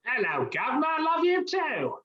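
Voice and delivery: lightly played British accent, easy and friendly; a hint of London in a gentle reply. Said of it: "you sound like you're from over the pond".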